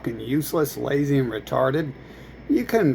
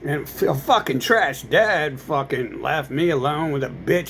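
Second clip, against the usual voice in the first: normal talking voice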